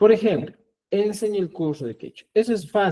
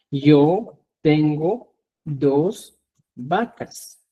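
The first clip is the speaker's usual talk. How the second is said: slow dictation; much slower than usual. Shown as a demonstration. Teacher dictating a sentence slowly.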